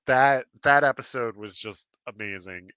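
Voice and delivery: ordinary talk, nothing marked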